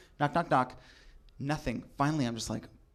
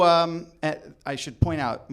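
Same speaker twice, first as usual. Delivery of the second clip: ordinary talk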